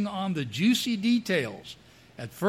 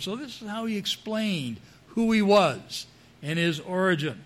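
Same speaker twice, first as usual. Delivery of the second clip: ordinary delivery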